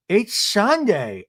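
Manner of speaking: high, drawn-out mock protest; Meredith, protesting. Meredith objecting to Seth leaving, high drawn-out protest. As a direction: stretch it out high, imitating an annoyed wife. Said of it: "Meredith in the scene goes".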